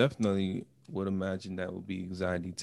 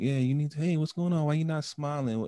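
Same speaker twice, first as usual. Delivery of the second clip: lightly voiced concerned friend, higher pitch; higher-pitched mock questioning; prodding friend; 8 semitones higher than usual. Friends poking at someone who is not themselves, prodding.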